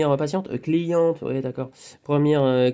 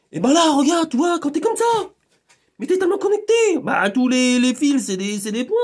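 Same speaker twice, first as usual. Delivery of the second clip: much higher and louder, bursting; excited; 9 semitones higher, 4 dB louder than usual. Real, not acted. Describing the hedgehog feeling to a friend, excited. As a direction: gush it out, thrilled.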